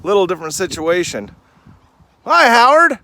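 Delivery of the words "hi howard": high, loud sing-song hello to a goat; bright, much higher call to an animal; pet talk. Real, not acted. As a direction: greet the goat like a beloved pet. Greeting his goat by name.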